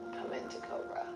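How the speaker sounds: normal talking voice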